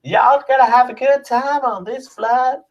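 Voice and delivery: put-on Southern US accent, pushed way up in pitch; high Southern US accent. Stewardess greets passengers in a high, hammed-up Southern US accent. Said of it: "What is that voice?".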